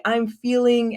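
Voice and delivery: "feeling" held high, unfinished; trailing off. Shown as a demonstration. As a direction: let "feeling" hang as if hunting for the word. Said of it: "and trail off".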